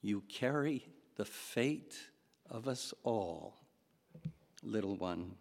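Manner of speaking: quiet, lingering repeat. Shown as a demonstration. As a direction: let the line sink in, hushed. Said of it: "Think of that line".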